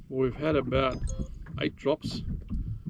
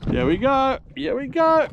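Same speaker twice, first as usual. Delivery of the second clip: excited; 12 semitones higher, 6 dB louder than usual. Real, not acted. Reeling in a fish.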